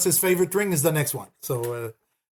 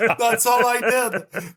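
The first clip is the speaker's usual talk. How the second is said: high, slow words through laughter; higher voice breaking into laughter; laughing; 7 semitones higher, much slower than usual. Real, not acted. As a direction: still cracking up as you talk. Laughing about teacher's harsh criticism.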